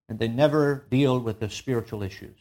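ordinary talk

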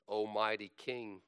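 ordinary delivery

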